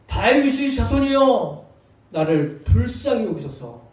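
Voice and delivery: very loud, high, slow call that eases into a pleading close. Acted. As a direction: cry out at full voice, stretching the words. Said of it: "더 큰 소리로". (더욱 큰 소리로 길게 부르짖기)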